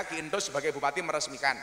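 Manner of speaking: ordinary talk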